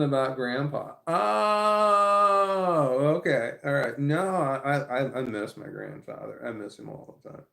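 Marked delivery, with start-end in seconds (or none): 1.1-3.0 surprised. Real, not acted. Sudden realization reading comment.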